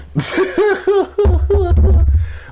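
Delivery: loud, high giggling laugh. Real, not acted. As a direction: break into a high run of giggles. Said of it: "I'm laughing because".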